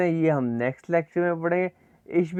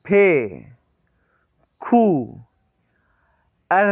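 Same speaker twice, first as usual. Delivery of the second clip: teacher calling letters one by one; alphabet recitation; 2 semitones lower, much slower than usual. Shown as a demonstration. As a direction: recite the alphabet for students to repeat. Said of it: "मेरे साथ साथ इसको pronounce करना".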